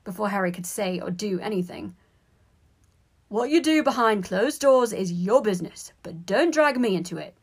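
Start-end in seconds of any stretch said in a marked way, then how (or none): 3.3-7.3 loud, irritated brush-off; heated, defensive telling-off; snapping. A narrator's reading. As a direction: refuse to be involved, voice raised. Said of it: "Ron snapped".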